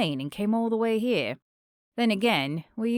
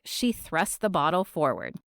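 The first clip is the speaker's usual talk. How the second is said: usual speaking voice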